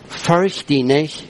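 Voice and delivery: slow, measured scripture quote; weighty, unhurried delivery; slow, deliberate. Retold or imitated. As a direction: draw the command out word by word. Preacher quotes God's command.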